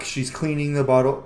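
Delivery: ordinary talk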